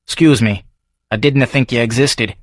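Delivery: gruff Edinburgh local sizing up a stranger; Davy's Scots brogue; Scottish barfly. Acted. Davy sarcastic.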